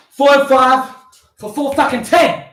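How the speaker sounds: punchy, high-energy rep count; counting out loud. Real, not acted. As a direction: call out the reps with force. Counting push-ups aloud while exerting.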